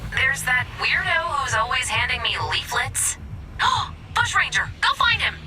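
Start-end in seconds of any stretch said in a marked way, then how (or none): 3.2-4.1 sudden gasp of realization; sharp gasp. Acted. Sudden idea of who can help. 4.1-5.4 higher, louder burst of urgency; excited realization. Acted. Excited realization, sends them off.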